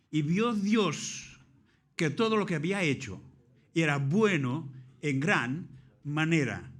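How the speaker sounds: preacher driving a Bible verse home; emphatic scripture quotation. Acted. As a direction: proclaim the verse slowly, phrase by phrase. Preacher declaims the verse he asked for.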